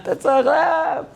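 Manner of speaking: very high, very slow strained call; exaggerated call. Shown as a demonstration. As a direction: overdo the greeting the wrong way. Das geht nicht so. (übertriebener, sehr hoher gedehnter Ruf)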